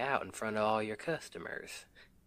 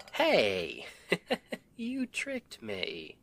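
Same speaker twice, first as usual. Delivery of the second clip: loud hey, then laughing higher; playful laughing outrage at being tricked; laughing protest; 9 semitones higher, 4 dB louder, much slower than usual. Acted. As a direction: laugh as you realize you've been tricked. Caught out by partner's trick.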